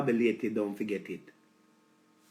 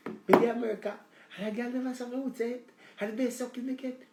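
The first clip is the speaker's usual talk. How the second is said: ecstatic woman; 6 semitones higher than usual. Acted. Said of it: "I say".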